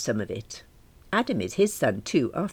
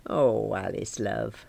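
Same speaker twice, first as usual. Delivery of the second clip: tender Dad; 4 semitones lower, slower than usual. Acted. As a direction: soothe a sad daughter. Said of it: "Dad said".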